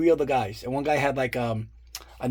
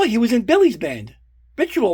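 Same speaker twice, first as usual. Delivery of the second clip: high, louder bright "oh" of recognition; lightly voiced wife, pitched up; wife's sudden recognition; 8 semitones higher, 4 dB louder than usual. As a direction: voice the wife realizing she knows him. Wife explains how she knows him.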